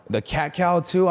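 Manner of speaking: usual speaking voice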